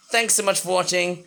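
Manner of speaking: normal talking voice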